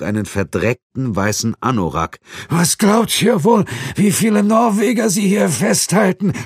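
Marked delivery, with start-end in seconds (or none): 2.5-6.3 desperate prisoner. A narrator's reading. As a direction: cry out in bitter despair. Beaten prisoner cries out. (verzweifelter Gefangener schreit)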